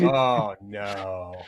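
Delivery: loud drawn-out "oh" sinking low; mock dismay. Telling co-host of dreading that song in Walmart, mock dismay. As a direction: groan it out, dropping away on "no". Retold reaction to hearing the song.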